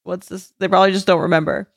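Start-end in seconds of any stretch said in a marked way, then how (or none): 0.0-0.5 slow, puzzled put-on voice; confused coworker. Coworker fumbling for a name, slow and puzzled. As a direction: drawl it out like someone who forgot a name. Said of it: "that's like".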